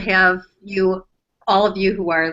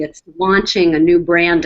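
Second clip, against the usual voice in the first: normal talking voice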